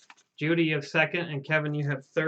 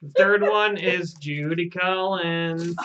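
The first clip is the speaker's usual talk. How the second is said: slow, loud, drawn-out winner announcement; raffle announcer; 2 semitones higher, 5 dB louder, slower than usual. Pretend. Announcing the third raffle winner, drawn-out announcer call. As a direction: belt the name out slowly for the crowd.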